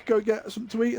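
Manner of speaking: ordinary talk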